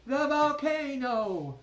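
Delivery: high, loud alarm; frantic cry; cried out. A narrator's reading. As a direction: yell it again. Punia keeps yelling alarm.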